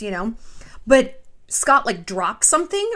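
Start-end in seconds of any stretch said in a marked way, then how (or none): none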